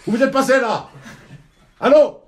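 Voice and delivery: very high, loud calling out. Acted. Secretary calls for the missing boss, high anxious call. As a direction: shout for someone who has vanished.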